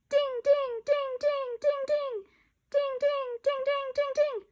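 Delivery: high bell-like dings; chiming dings. Acted. Kids imitating the sale notification, high chimes. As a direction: ring out like a chime.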